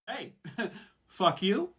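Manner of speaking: high questioning fuck you with a laugh; inviting. Pretend. Impersonating the line, sounding like an invitation. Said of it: "that's not an invitation".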